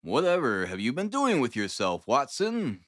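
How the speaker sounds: usual speaking voice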